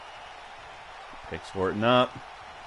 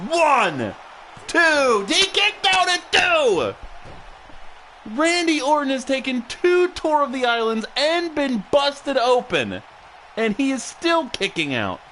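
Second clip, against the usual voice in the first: rising, louder play-by-play call; wrestling commentator; 12 semitones higher, 4 dB louder, slower than usual. Acted. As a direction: call the near-fall live. Live play-by-play excitement.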